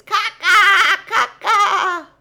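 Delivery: high, loud jeering laugh; mocking laughter. Town women laughing at the poet, loud mocking jeer.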